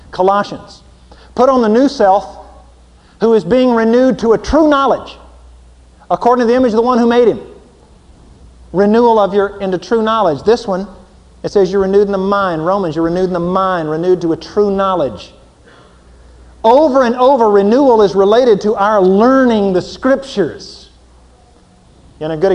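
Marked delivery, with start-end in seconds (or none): 1.3-7.4 preaching scripture. Acted. 16.6-20.9 preaching. Acted. Preaching at full force.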